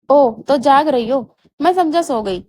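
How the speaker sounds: ordinary delivery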